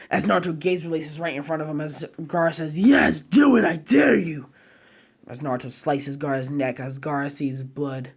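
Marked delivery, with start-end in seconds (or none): 2.8-4.5 loud, higher mocking challenge; loud taunting character voice; taunting dare. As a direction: goad him to strike. Gaara taunts Naruto.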